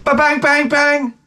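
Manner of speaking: high, playful banging noises; tossing the manual in the garbage; mock bang sounds. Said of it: "they're like".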